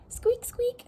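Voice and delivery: tiny high-pitched squeaks; nervous mouse squeak. A narrator's reading. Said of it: "said Mouse nervously".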